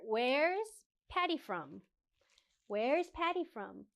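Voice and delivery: "Where's Patty from?" said slowly, tune dropping on "from"; falling intonation. Shown as a demonstration. Teacher demonstrates the falling question tune to learners. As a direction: bring "Where's Patty from?" down at the end. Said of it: "the intonation goes down a little bit".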